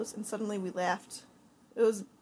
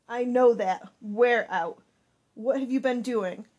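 angry scolding; 10 dB louder, slower than usual. Acted. Angry mother scolding daughter.

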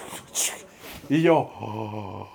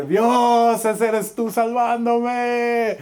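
much higher, louder and slow, marvelling; awed praise; 9 semitones higher, 4 dB louder, much slower than usual. Retold or imitated. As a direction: proclaim God's rescue in wonder. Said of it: "y dije".

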